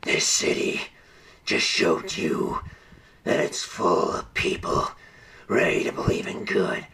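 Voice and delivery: whisper-growl like the Dark Knight; imitating Batman's growl; growly Batman voice. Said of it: "his growly voice".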